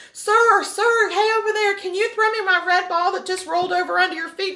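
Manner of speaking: put-on high-pitched kid calling out; little kid voice. Kid asking a stranger for his ball back, high little-kid voice. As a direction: pipe up high like a little boy. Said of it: "can you imagine some little kid".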